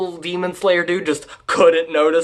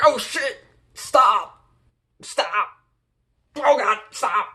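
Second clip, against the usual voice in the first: high, strained mock-panicked pleading; 7 semitones higher than usual. Brother begs demon sister to stop, mock panicked. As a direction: plead in short, pitched-up bursts. Sister is trying to murder him.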